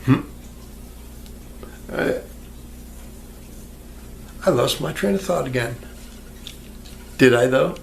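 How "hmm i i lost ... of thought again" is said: ordinary talk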